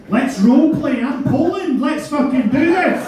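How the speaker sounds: high, loud gung-ho rallying cry; eager excitement. Pretend. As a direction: psych yourself up, high and loud.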